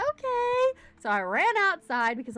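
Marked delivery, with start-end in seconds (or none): 0.0-0.8 high, sing-song "okay", like being called away mid-stream; a lightly hammed-up chirpy okay, higher; mock surprised okay. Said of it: "And I was like".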